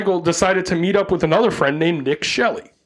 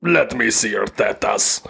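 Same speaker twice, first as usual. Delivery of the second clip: creepy low voice; 6 semitones lower, slower than usual. Pretend. Joking lewd line about tetas.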